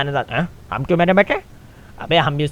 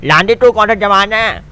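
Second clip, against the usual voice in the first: louder and higher, called out across the car; relaying the taunt loudly to the other guy; loud repeat; 6 semitones higher, 7 dB louder than usual. Shown as a demonstration. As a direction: shout the taunt so he hears. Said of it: "Pandey तेरे को बोल रहे हैं".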